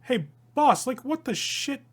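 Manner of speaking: high, loud alarmed call; startled outburst. Acted. Damien shocked at the scene: startled shout. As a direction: blurt it out to the boss in shock. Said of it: "he's like".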